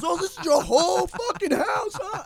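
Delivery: high-pitched mock taunt; imitating belligerent drunk. Drunk friend disputes whose house it is, mock belligerent. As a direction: goad like a drunk escalating a petty argument. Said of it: "Zach is belligerent drunk".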